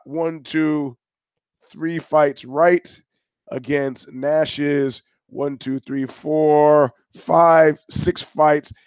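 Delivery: very slow, drawn-out count; slow, deliberate tally. Real, not acted. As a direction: drag each word out. Counting picks slowly.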